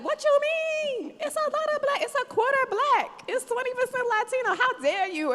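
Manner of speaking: very high, loud, indignant outburst; offended. Retold or imitated. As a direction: fire it back, mock-offended and defending the city. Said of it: "And I was so offended".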